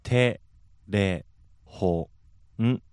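テ レ ホ ン each said alone with pauses; syllable by syllable. Shown as a demonstration. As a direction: break テレホン into single morae. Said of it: "just break it down".

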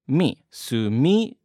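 slow "sumimasen", going up on "mi", down on the last n; pitch accent demo. Shown as a demonstration. Teacher breaking the word apart for learners, slow pitch demo. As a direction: spell out the melody of "sumimasen" beat by beat. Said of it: "tone went up on the second syllable".

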